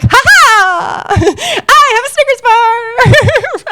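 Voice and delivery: high, slow, laughing crow of victory; gleeful triumph. Retold or imitated. Contestant crowing to Tyra Banks about her Snickers bar, gleeful and high. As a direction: crow it like you just won the game.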